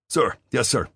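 loud, high military reply; barked reply. A narrator's reading. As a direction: snap it out like a soldier. Private barking response to officer.

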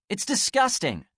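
high disgusted jab; snapping. Acted. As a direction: spit out the disgust. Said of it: "she snapped".

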